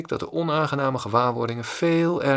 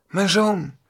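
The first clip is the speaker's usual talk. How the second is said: dismayed realisation; 7 semitones higher, 4 dB louder than usual. Acted. Shocked realisation it's his son.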